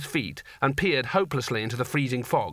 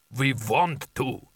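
rumbling giant; much slower than usual. A narrator's reading. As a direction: rumble it like a huge ice giant. Giant figure.

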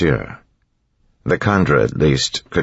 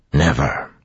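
flat certainty. Acted. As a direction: close the matter firmly. Orsur flatly confirming.

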